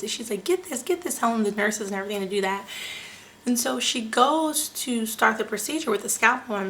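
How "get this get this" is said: high-pitched retold urgency; urgent orders. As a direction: imitate a doctor urgently directing nurses. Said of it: "have urgency in her voice".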